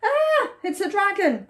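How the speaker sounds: high-pitched storybook alarm; high gasp into a panicked cry; frightened cry. A narrator's reading. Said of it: "cried the forest animals".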